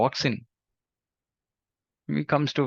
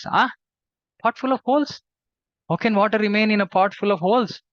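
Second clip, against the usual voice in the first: surprised; 5 semitones higher than usual. Acted. Villagers react to strange condition.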